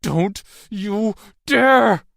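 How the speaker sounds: high, loud, each word separate; slow, forceful, protective yell; stronger shout. A narrator's reading. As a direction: shout a fierce warning. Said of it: "her voice stronger now".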